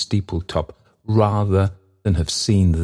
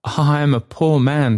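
high, humble faltering voice; faltering Trotty; 8 semitones higher than usual. A narrator's reading. Trotty admits his poverty to the bell, faltering. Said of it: "faltered Trotty".

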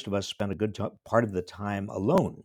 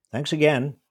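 raised, higher call down a corridor; calling after; 8 semitones higher, 7 dB louder than usual. Retold or imitated. Calling thanks after Bill down the corridor. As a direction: call out thanks to someone walking away. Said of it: "I called after him".

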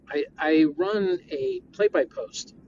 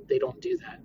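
ordinary talk, nothing marked

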